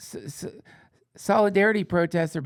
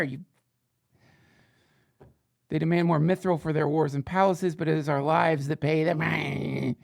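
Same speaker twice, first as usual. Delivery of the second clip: usual speaking voice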